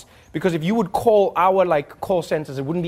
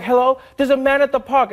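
higher-voiced caller calmly reporting a man at the park; 7 semitones higher, 4 dB louder than usual. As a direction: announce it simply, like a caller. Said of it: "you'd be like".

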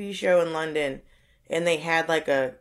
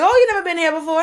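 higher, louder mock-surprised question; teasing old man; 11 semitones higher, 6 dB louder, faster than usual. Old men teasing the confused newcomer, high mock surprise. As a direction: feign innocence to tease him.

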